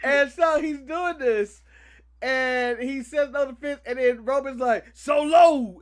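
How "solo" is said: imitating a louder yell of Solo; retold yell. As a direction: call the name out, hammed-up. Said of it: "he just yells".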